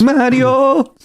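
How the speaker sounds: high, loud cartoon Luigi cry; Luigi impression. To his friend, shouting Mario in a Luigi voice. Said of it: "my best Luigi impersonation".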